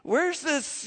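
ordinary delivery

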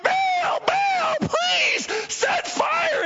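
very high, loud drawn-out scream; frantic screaming. Retold or imitated. Prophets scream at their god for fire, frantic. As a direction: scream to Baal in desperation. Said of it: "yelling even louder and screaming out".

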